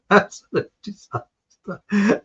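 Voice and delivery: helpless laughter through the words; drawn out through a laugh. Real, not acted. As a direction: crack up while saying it. Laughing at his own memory.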